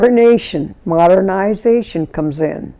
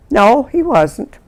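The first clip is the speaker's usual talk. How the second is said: ordinary talk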